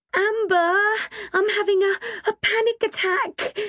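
very high, tearful panic; panicked yelp. A narrator's reading. Kim panicking up the ladder, yelping to her friend. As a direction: yelp it, stumbling over the words. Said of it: "she yelped".

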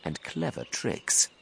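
soft, slow, high scheming voice; sly enormous crocodile. A narrator's reading. Crocodile revealing his secret plans, soft and sly. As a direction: confide it quietly with smug relish. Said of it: "he said".

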